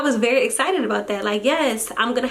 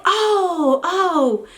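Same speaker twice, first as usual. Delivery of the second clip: high, flustered oh-oh-oh realisation; flustered doctor; 5 semitones higher, much slower than usual. Retold or imitated. Scene: doctor realises the mom came to meet her, flustered and high. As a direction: play the doctor caught out, higher. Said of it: "She was like".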